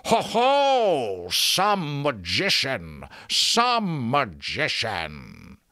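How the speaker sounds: loud, high jeer, drawn way out; loud mocking cry. A narrator's reading. Enchanted lock jeering at the failed magician, loud mocking cry. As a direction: crow it in a slow, taunting character voice. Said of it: "it cried out loudly".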